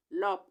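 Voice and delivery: लॉप with an open 'aw' vowel; correct reading. Shown as a demonstration. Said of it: "इसको पढ़ेंगे लॉप". (लॉप, खुले 'ऑ' स्वर के साथ)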